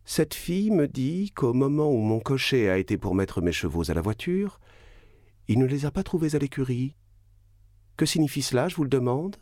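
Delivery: voix plus aiguë, inquiète et pressante; inquiétude soudaine. Acted. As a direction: une femme qui vient de pâlir et exige une explication. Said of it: "Madame Danglars pâlit". (la baronne inquiète interroge son mari, voix plus aiguë)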